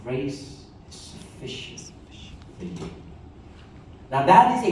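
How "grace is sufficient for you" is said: whispered, low and soft; hushed voice of Jesus. Acted. Jesus answering Paul's plea in a soft whisper. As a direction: breathe it gently as a divine whisper. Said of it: "Jesus says".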